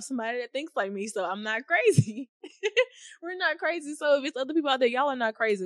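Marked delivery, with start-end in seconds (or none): 1.1-3.2 laughing through the words. Real, not acted. Speaker reassuring herself with a laugh. 3.2-5.6 higher, bright reassurance to the listeners; excited and reassuring. Real, not acted. Speaker reassures fast-thinking listeners, excited and high.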